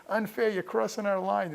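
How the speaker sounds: mild mock yell, pitched high; retold scream. Striking son protests to his dad, mild retold scream. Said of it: "And I'm screaming".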